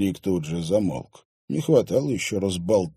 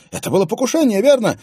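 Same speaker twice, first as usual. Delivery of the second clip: high, loud excited outburst; a young man bursting out in triumph; excited exclamation; 9 dB louder, faster than usual. Acted. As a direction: blurt it out, sure he was right. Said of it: "воскликнул Клим".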